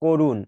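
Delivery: করুণ with a rounded "oh" first vowel; correct closed ও. Shown as a demonstration. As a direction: pronounce করুণ as "KOH-run". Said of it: "এটাও ও হয়ে গেছে". (করুণ, ক-তে ও-এর উচ্চারণ)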